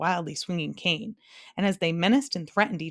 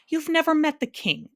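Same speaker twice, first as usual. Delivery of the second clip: high, loud angry bee; angry accusation; 10 semitones higher, 5 dB louder than usual. A narrator's reading. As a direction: snap the charge at him. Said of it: "accused the bee angrily".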